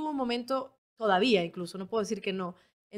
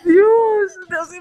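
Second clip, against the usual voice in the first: mock sob; 8 semitones higher, 15 dB louder, much slower than usual. Mock crying continues.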